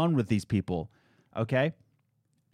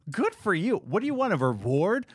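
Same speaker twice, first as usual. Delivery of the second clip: higher, mock-bright put-down; sarcastic praise; 6 semitones higher than usual. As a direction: fake cheer that drips contempt. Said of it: "I was just like".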